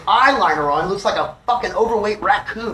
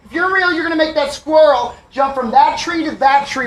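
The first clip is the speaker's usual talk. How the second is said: high, loud, insistent demand; yelling at the sky; 8 semitones higher, 5 dB louder than usual. Retold or imitated. Man demanding squirrel miracle from higher power, acted-out yelling. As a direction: holler another challenge at the heavens. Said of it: "I'm yelling at the sky".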